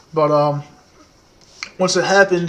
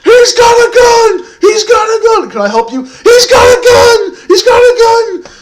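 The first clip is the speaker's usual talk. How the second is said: much higher, louder, slow shrieking with a break into laughter; panicked screaming; 9 dB louder than usual. Retold or imitated. Teen screaming to the 911 operator, panicked and shrill. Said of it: "phone call nine one one".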